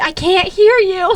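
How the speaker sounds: mock coach shout. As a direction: bellow it like a cheer coach. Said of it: "she's like".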